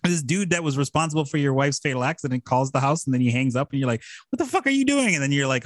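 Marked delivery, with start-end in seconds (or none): 4.3-5.1 much higher, baffled outburst; hammed-up shock at a creepy caller; mock outrage. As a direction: bark it out, rattled and high. Reacting to creepy phone call.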